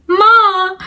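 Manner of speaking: high, loud mock wail for his mother; whimper-call for mom, overplayed; imitating a crying call. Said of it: "and he's crying. He's like".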